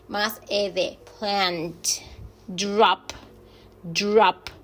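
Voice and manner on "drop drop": «Drop» said «drap», a little louder; model English word. Shown as a demonstration. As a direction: say «Drop» twice, clearly. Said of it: "Le agregamos".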